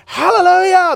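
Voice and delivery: high, loud hallelujah shout; imitating crowd yelling. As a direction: yell it back like a whole congregation. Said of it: "They're yelling and they're jumping".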